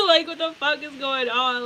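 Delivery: loud laughing question. Real, not acted. Laughing in disbelief at her flirting ex. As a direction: laugh your way through it.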